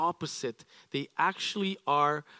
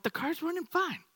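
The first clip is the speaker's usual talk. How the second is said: much higher, exasperated objection; mock protest; 11 semitones higher, 3 dB quieter than usual. Spouse objecting to taking the car apart, high and exasperated. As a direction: push back on the tinkerer. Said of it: "I'm like".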